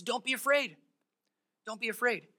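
soft grounded reassurance; calm reassurance. Acted. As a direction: settle the servant down gently. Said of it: "Elisha keeps him grounded".

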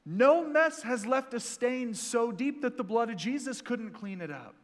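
ordinary talk

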